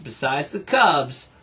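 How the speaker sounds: ordinary delivery, nothing marked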